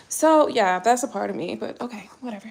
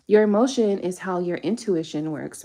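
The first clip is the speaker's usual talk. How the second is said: ordinary talk, nothing marked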